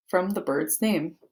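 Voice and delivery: normal talking voice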